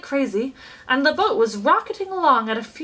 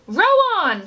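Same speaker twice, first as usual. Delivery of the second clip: louder, higher command to the rowers; shouting; 9 semitones higher, 5 dB louder than usual. A narrator's reading. Chocolate maker urging the rowers on, shouting. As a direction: call out over the boat. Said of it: "shouted Mister Wonka".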